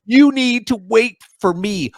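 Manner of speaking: high, slow whiny entitled demand; mock Karen. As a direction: whine it like a petty entitled biker. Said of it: "male Karens on their bike going".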